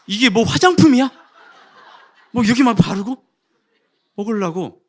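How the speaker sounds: high, loud, laughing outrage; mock indignant retort. Husband defending his ice cream to his wife, mock outraged. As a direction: fire back in comic disbelief.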